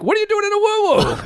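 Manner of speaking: a hammed-up shout of disbelief; mock baffled. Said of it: "I'm like".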